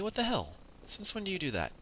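baffled, voice shooting high; real surprise. Real, not acted. Player baffled as a zombie runs from him, real surprise. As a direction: pitch jumps way up in disbelief.

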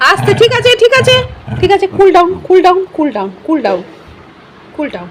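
high, loud calming-down calls; urgent placating. Real, not acted. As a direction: talk it down urgently, pitch easing gradually.

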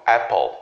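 Apple with an E sound at the start; correct pronunciation. Shown as a demonstration. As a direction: open Apple on an E. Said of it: "ele vai ter som de E".

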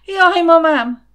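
high, very loud terrified shriek; scream of fright. A narrator's reading. Smaller intruder grabbed by the leg, screaming. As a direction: cry out high as if suddenly grabbed.

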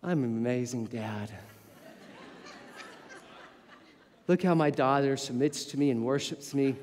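low, quiet, mock-proud inner voice; a hint of a puffed-up ego, spoken low; smug self-praise. Father imagining pride in himself, low and smug. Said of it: "I'm not thinking to myself".

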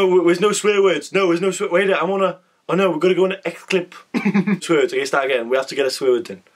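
ordinary delivery

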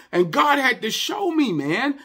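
high, loud, insistent pulpit cry; preaching at full force. Real, not acted. Pastor warning the congregation about pleasing people, preaching at full force. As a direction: drive each phrase home at high pitch.

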